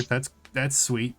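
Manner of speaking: ordinary talk, nothing marked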